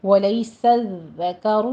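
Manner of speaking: ولیس الذکر with the dhal doubled and no hiss; ولیس الذکر recited slowly; slow model of ولیس الذکر; tajweed pronunciation. Shown as a demonstration. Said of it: "اس لفظ کو underline کر لیجئے".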